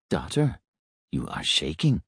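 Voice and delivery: soft, kind robot voice; gently concerned, quiet; compassionate. A narrator's reading. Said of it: "compassion in his voice".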